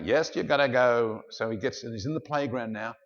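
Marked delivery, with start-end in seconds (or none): 0.0-1.2 loud, lightly acted firm mum; insistent mother. Mother overrules her pleading son, loud acted insistence. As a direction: overrule the pleading child firmly and loudly. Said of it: "Pushes him off to school".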